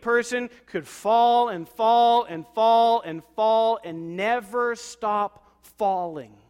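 preaching shout. Real, not acted. Pastor to church, shouting slowly about falling. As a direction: build it up like a preacher's cry.

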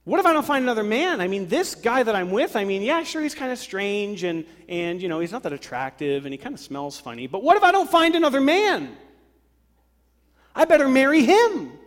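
high, loud, panicky voice of a woman afraid to stay single; rising to a desperate squeal at the end. Retold or imitated.

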